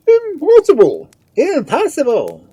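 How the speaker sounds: high, loud outcry from yes-men; scandalised high-pitched disbelief; incredulous echo. A narrator's reading. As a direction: exclaim it shrilly as echoing hangers-on. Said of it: "echoed the satellites".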